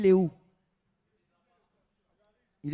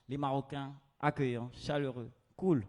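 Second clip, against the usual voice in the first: ordinary talk, nothing marked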